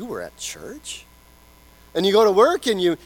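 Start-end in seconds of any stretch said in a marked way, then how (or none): 0.0-1.1 low, soft, slow disbelieving question; a colleague raising an eyebrow at you; hushed doubtful you were at church; skeptical coworker. Retold or imitated.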